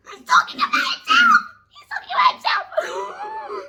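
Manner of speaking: high, loud squealing laugh, slowing on the repeat. Real, not acted. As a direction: shriek with delighted laughter. Fan screaming over love line.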